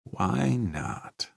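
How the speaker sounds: soft, glum mutter; gloomy mutter. A narrator's reading. Janitor answering the technician, gloomy mutter. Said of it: "muttered gloomily".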